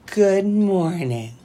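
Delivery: drawn-out, slow mock greeting; exaggerated, very slow imitation; mocking the cheery phrase. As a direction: drag out the greeting to make fun of it. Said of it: "Well, not like that".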